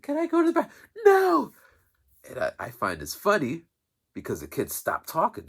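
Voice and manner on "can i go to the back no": much higher, louder mock exchange; high put-on voices for kid and lady; imitating the office lady. Kid asks, lady refuses.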